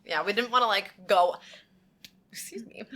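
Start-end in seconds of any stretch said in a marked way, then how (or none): 2.3-2.8 soft whisper; whispered apology. Real, not acted. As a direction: breathe it under your breath. Excusing herself after burp.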